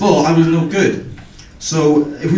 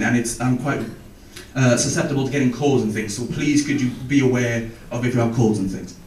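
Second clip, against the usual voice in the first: a softer, lower, earnest teacher voice; gentle teacher; 4 semitones lower, 6 dB quieter than usual. Retold or imitated. Welsh teacher asking the kids to cover coughs, softly earnest. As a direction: quietly ask the kids for care. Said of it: "and he said".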